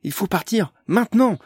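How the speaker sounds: sudden urgent cry, high and loud; Amélie bursts out yelling at the group; shouting. A narrator's reading. Amélie cries out to leave, loud and high. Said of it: "se mit soudain à crier Amélie".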